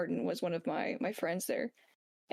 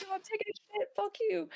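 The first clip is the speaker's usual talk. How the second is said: high, strained mock voice; hammed-up sergeant refusing, much higher; defiant sergeant; 12 semitones higher than usual. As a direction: snap back while pitched way up. Friend voiced in story.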